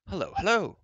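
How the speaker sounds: high, loud happy greeting; glad shout. A narrator's reading. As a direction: call out joyfully to the parents. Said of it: "Shouting a glad".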